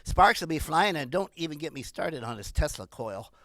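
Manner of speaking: normal talking voice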